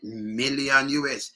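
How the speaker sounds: MILLION slowly drawn out from the M; stress on MILLION. Shown as a demonstration. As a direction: lean hard on the M of MILLION. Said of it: "you put all emphasis on it".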